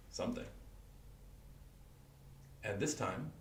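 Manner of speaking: ordinary delivery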